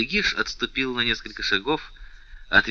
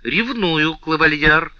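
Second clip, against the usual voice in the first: higher, proud and defiant; haughty; 7 semitones higher, 5 dB louder, slower than usual. A narrator's reading. The princess defiantly admits jealousy, haughty. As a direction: fling it at him with contempt. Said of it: "надменно сказала принцесса".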